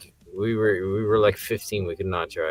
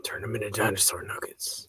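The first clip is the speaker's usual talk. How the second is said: soft unvoiced whisper; joining the friend's spooky voices; mock whispering voice; 9 dB quieter than usual. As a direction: whisper like a voice in his head.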